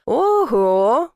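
drawn-out, mock-impressed "oho"; impressed surprise. A narrator's reading. Twin spots the heroine in the bushes, impressed surprise. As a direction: exclaim it like an amazed whistle. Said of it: "присвистнул один из них".

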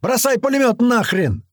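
very high urgent shout; high-pitched frantic order; yelling. A narrator's reading. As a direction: holler the order down, pitch far up. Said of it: "орёт ему вниз Туман".

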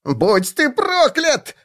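high, slow wail; a drawn-out high howl; howling curse. A narrator's reading. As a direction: howl it at the dwarf. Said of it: "взвыл тот".

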